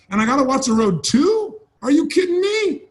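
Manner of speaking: high-pitched mock incredulity; overwhelmed disbelief. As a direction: act out a flustered learner, voice climbing in protest. Acts overwhelmed new stick-shift driver.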